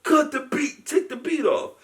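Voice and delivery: high yelling stop call; Ghostface shouting. Retold or imitated. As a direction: yell to cut the music, high and loud. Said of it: "He's like".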